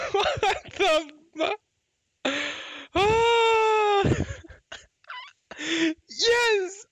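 high, excited cries with laughter; overjoyed outburst. Real, not acted. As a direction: cry out in relief after a long losing streak. Overjoyed after four hundred pulls.

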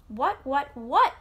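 exasperated, building to a loud last word; storybook fox losing patience; shouted. A narrator's reading. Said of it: "shouted the fox".

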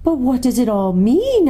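high, emphatic question; bewildered girl's plea; puzzled girl. A narrator's reading. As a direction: ask high, eager to understand. Susan puzzled, asks the lion.

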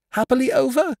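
high-pitched eager question; excited cry. A narrator's reading. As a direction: cry out high, hoping for good news. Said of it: "cried Dot".

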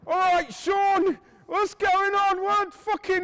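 high, loud, drawn-out yell; acted panicked shout; alarmed shouting. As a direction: call out in alarm to a hurt mate. Mark alarmed, calling to hurt friend.

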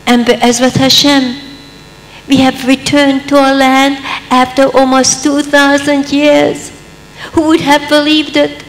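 high, loud rousing sermon; preaching at full force. Acted. Speaker rousing the audience about returning to the land, fervent. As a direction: rise to a fervent climax.

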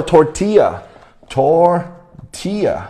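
tortilla said slowly with the LL as Y; double L as Y. Shown as a demonstration. Teacher models tortilla for the class, slow with LL as Y. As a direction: draw out tortilla, a Y sound for LL. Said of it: "L L发Y的音".

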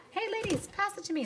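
high-pitched mock chirpy request; mock classmate. Acts out someone in class.

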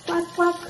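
put-on high quacking for a toddler; mother showing her son the duck sound. Shown as a demonstration. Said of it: "Make the sound of the duck".

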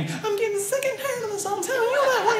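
very high, thin-volumed yell; mad wife's pretend yell. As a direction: yell high in pitch without raising the volume. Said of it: "she gets mad and starts yelling".

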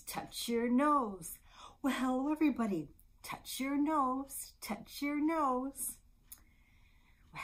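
loud, lilting, slowed-down instructions; baby game singsong. Shown as a demonstration. Librarian leading babies in a touch game, playful singsong. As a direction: chant it playfully, stretching each action.

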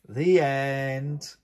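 lilting storybook read-aloud; reading to children. A narrator's reading. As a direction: read the poem to little ones, gently sing-song. Reads Christmas poem to children.

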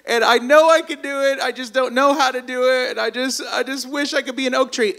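high, hammed-up tearful acorn; exaggerated whiny blubbering, high-pitched; mock crying. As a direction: wail it out like a sobbing little acorn. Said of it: "hears the little acorn crying".

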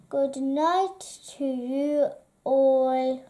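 ordinary delivery

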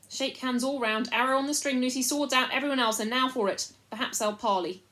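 rally the troops, driving and urgent; rallying orders. A narrator's reading. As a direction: call out orders before a fight. Caspian gives orders before battle.

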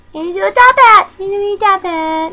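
high, drawn-out sing-out, loud then easing; gleeful presenting. Acted. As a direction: crow it slowly, showing off a prize. Gleefully presenting the mini top hat.

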